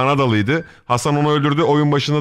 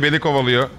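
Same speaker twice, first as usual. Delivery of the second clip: normal talking voice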